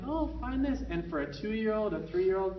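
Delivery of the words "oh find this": high, bright encouraging; excited invitation. Shown as a demonstration. Parent turning cleanup into a game, excited. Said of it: "something that empowers".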